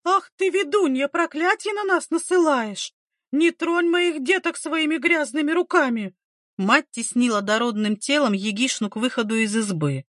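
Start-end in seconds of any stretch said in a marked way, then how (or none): 0.0-6.1 high-pitched, hostile village-woman cry; wailing accusation. A narrator's reading. Mother attacking the midwife, wailing accusation. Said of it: "разнося по избе вопли".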